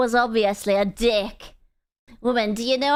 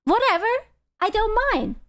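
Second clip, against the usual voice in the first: easygoing mock reply; 9 semitones higher than usual. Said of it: "I would have been like".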